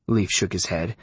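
ordinary delivery, nothing marked

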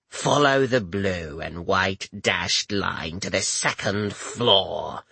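low, slow scraping rasp; gravelly voice. A narrator's reading. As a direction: scrape it out like coarse rocks. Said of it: "The woman's voice was like two rocks scraping together".

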